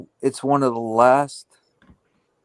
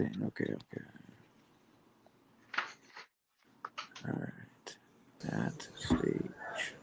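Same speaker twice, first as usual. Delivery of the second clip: breathy whisper to himself, much softer; whispered to self; 11 semitones lower, 16 dB quieter, slower than usual. Real, not acted. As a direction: mutter it under your breath while busy. Whispers to himself while setting up.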